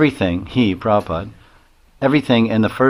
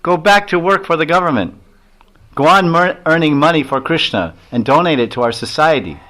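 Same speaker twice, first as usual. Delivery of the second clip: higher, louder command, half acted; Swamiji's firm order; 6 semitones higher, 4 dB louder than usual. Swamiji telling the disciple to keep his job, firm and raised. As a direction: urge him firmly to go back to work. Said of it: "he said".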